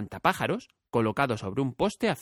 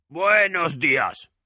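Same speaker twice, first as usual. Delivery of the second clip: slow, higher, rasped greeting; hoarse scarecrow; 5 semitones higher, 6 dB louder, much slower than usual. A narrator's reading. Scarecrow greets the girl from his pole, hoarsely. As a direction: creak out a friendly hello. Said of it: "con voz algo ronca".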